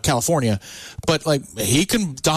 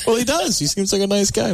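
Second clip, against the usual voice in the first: higher-pitched mock defence; retold protest; 7 semitones higher than usual. Retelling an exchange about a player, mock protest.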